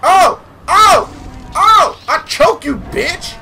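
high excited taunting shouts; gleeful taunting yells. Real, not acted.

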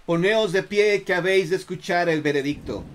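loud stern judge command; commanding order. Acted. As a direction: order the prisoner to stand. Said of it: "ordenó el Marqués". (orden severa y fuerte)

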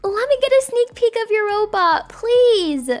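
ordinary delivery, nothing marked

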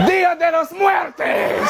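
high, slow, mock Spanish shout. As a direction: yell it like a hammed-up ghost. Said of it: "just heard".

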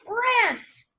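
high, drawn-out cheer; delighted approval. Real, not acted. Coach cheers trainee for the right answer, elated. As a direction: celebrate the answer with a whoop.

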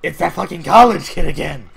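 exasperated yell. Acted. Spots a recurring nuisance in game.

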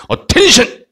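high-pitched command to the troops; army general. Acted. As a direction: call troops to attention, mock-military. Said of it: "장군이".

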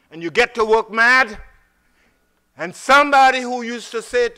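high, loud, building preach; rising fervour over the bad morning. Real, not acted. Preacher telling the congregation about road anger, loud.

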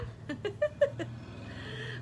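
soft, high, breathy laugh; leftover giggles. Real, not acted. Seller relapsing into giggles over the name mix-up, laughing softly. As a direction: let a long giggle slip out.

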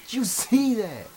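can't believe what just happened; amazed. Real, not acted. Gamer shouting to his teammate over a lucky kill, amazed.

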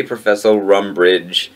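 ordinary talk, nothing marked

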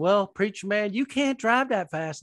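hammed-up Southern cop; Southern state trooper. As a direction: play a Southern trooper calling out the preacher. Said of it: "pulled over by a state trooper".

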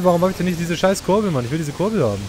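ordinary delivery

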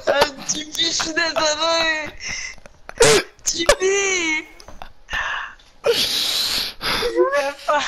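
high whining sorry; guilty apology. Real, not acted. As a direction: wail the apology, slowing. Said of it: "j'ai tué mon coéquipier".

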